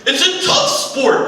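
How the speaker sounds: high, slow indignant protest; hammed-up offended jock; mock outraged wrestler. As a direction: defend the sport in a squeaky huff. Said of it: "it's like".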